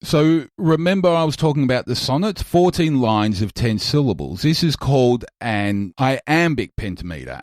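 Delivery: normal talking voice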